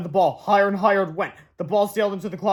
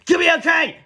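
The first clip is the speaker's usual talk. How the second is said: much higher, louder chant; cheerleader shout; 10 semitones higher, 7 dB louder than usual. Acted. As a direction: yell it to the crowd. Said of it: "shouted the cheerleaders".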